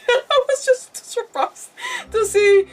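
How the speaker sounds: high, slow, laughing; laughing surprise. Real, not acted. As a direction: giggle through it, slowly. Laughing about surprising card.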